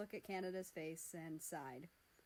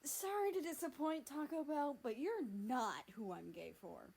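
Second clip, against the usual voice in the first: sarcastic; 4 semitones higher than usual. Acted. Sarcastic Canada voice.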